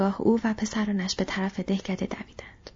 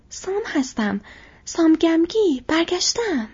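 calling out; 8 semitones higher, 5 dB louder, slower than usual. Acted. Sam calls out to farmer.

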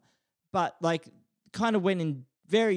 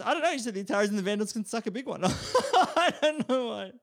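laughing; 6 semitones higher than usual. Real, not acted. Laughing at the Vandals remark.

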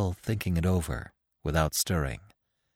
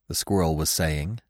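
plain reading voice

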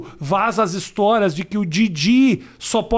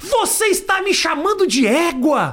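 high, slow, scandalised question; mock offence; 6 semitones higher, slower than usual. Comedian mimicking the presenter twisting his words, mock outrage. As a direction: imitate someone hugely affronted, each word stretched.

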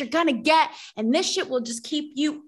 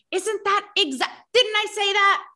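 high-pitched mock disbelief; acted vindicated astonishment; 8 semitones higher than usual. Host retells her thought on hearing the speech, astonished. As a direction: blurt it high, as if proven right.

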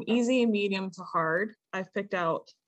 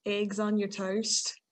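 slow Irish attempt gone British; very British accent; much slower than usual. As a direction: aim for Irish, land on British. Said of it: "It was very British".

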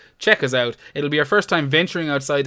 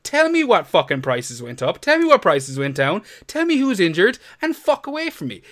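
angry rant; 6 semitones higher than usual. Real, not acted. Podcast host ranting about the FPL Twitter account, heated and building. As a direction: vent at the Twitter account, voice climbing. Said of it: "I love your enthusiasm".

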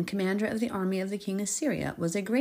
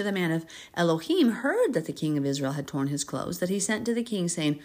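ordinary talk, nothing marked

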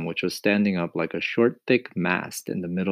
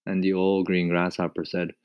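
usual speaking voice

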